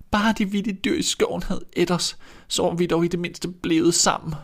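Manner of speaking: hint of sobbing; tearful girl. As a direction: lament like a grieving little girl. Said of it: "Tårerne løb".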